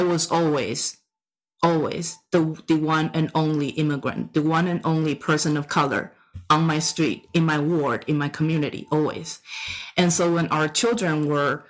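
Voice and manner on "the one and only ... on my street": usual speaking voice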